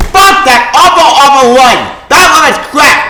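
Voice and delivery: high, loud furious yelling at a run he hates; furious shout. Real, not acted. Fan raging at the comic writer, furious shout. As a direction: spit out the curses at full volume.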